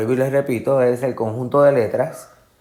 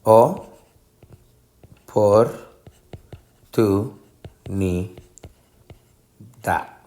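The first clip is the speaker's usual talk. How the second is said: "O, por, tu, ni, da" with long pauses between; syllable by syllable; much slower than usual. Shown as a demonstration. Teacher dividing a word into syllables, one isolated syllable at a time. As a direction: break it into separate syllables, very slowly. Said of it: "Esto es cómo se divide en sílabas".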